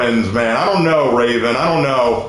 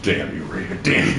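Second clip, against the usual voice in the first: much softer, lower mock curse; mock cursing; 10 semitones lower, 6 dB quieter than usual. Absent friend cursing Raven from afar, soft mock curse. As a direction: mutter a joke curse under your breath. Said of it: "He's like".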